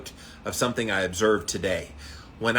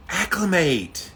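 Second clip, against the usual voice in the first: acclimate said slowly, higher, stressed; 8 semitones higher, slower than usual. Real, not acted. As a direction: draw out acclimate slowly, up high. Stressing the word again.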